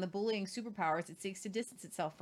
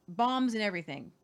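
usual speaking voice

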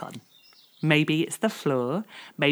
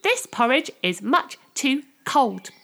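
mildly voiced disgusted Goldilocks; 9 semitones higher, slower than usual. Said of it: "said Goldilocks".

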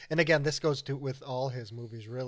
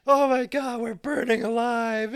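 burning scream; 9 semitones higher, 8 dB louder than usual. Retold or imitated. Retold burning car scene.

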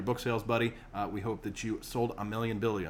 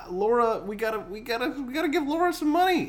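high-pitched; 11 semitones higher, 5 dB louder than usual. Acted. Pleading about paying Laura.

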